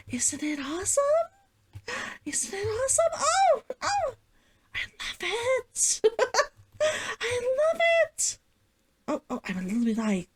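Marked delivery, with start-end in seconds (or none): 0.1-1.0 soft whispered question. Real, not acted. Asking viewers about the costume, whispered. 2.2-8.4 high squealing gush with a laugh breaking in; giddy squeal. Real, not acted. Gushing to viewers over her cosplay, giddy squeal.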